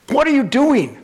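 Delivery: high-pitched, incredulous challenge; indignant demand. Retold or imitated. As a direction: act out a bishop confronting the staff. Bishop confronts camp staff.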